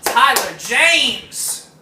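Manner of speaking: loud slow ring announcer intro; announcer call. Pretend. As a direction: boom it like a fight announcer. Announcing host to audience.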